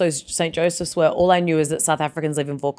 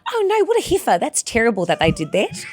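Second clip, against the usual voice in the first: high, appalled mock Kiwi; strong New Zealand accent; 5 semitones higher than usual. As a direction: exclaim in a very broad Kiwi accent. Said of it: "that's, like, really strong Kiwi".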